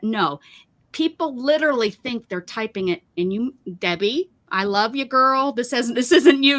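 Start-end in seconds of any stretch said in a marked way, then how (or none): none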